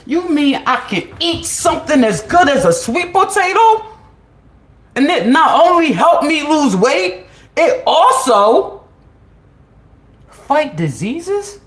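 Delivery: high, loud astonished questions; mock amazement. Asking her viewers in loud mock astonishment. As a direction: act stunned and thrilled.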